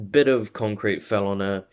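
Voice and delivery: plain reading voice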